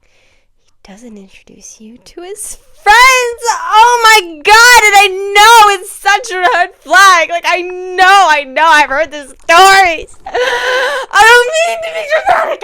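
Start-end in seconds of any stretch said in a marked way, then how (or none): 2.6-12.6 loud, high wailing rant; dramatic outburst. Real, not acted. Vlogger reliving a red flag she knows well, dramatic wailing. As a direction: wail it out at full drama. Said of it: "I really don't mean to be dramatic".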